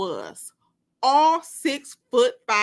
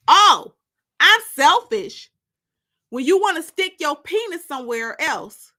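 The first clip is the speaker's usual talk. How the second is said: a high, much louder furious shout; furious outburst; 6 semitones higher, 8 dB louder than usual. Acted. Destiny shouting furiously at Terry in the hallway. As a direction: throw the accusation back in his face.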